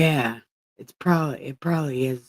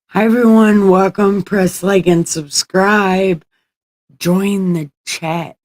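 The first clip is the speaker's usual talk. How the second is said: announcer voice; 7 semitones higher, 10 dB louder than usual. Acted. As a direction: hype up the stream intro. Fake streamer greeting to viewers.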